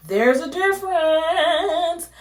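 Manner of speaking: high, loud, slow emphasis; dramatic high line. Real, not acted. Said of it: "dramatic emphasis".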